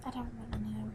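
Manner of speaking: whispered aside; a quick whispered shrug. Real, not acted. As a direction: drop to a breathy whisper. Unvoiced aside.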